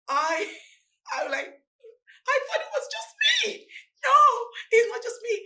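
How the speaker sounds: high, laughing cry of recognition; real laughter breaking into high pitch; laughing in disbelief. Real, not acted. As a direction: laugh it out, almost crying. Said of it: "laughing disbelief".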